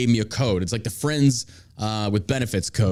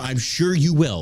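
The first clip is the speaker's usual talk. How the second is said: normal talking voice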